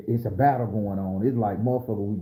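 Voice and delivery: usual speaking voice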